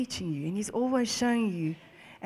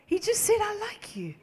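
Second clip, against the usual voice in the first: overcome; 11 semitones higher than usual. Retold or imitated. Retells being moved to bawl.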